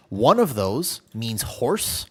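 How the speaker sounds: normal talking voice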